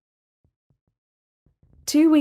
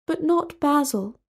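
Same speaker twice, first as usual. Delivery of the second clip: sad; 6 semitones higher than usual. Acted. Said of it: "said Talia sadly".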